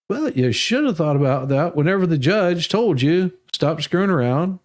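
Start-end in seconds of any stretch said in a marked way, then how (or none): none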